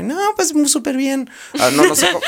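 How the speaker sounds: high-pitched imitated girlfriend; woman voice. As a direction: squeak it up like a girlfriend. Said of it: "A ver, haz una voz de mujer". (voz de mujer aguda imitada)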